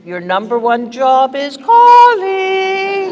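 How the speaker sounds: building to a loud, high, long-held last word; playful call-out. Real, not acted. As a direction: announce it slowly, then sing out CALLING. Calling out for a child's mum.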